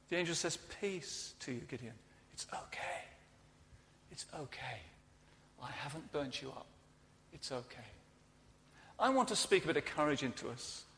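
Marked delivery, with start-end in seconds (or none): 0.8-1.9 much softer, gentle reassurance; the angel calming a terrified Gideon, very soft and reassuring; angel's soothing peace. Acted. Angel calms frightened Gideon. 2.3-3.0 whispered reassurance; angel's whisper. Acted. The angel whispering to a terrified Gideon, hushed. 4.1-7.9 much softer, gently voiced reassurance; the angel softly reassuring Gideon after the fire, gentle; angel's soft comfort. Angel calms frightened Gideon.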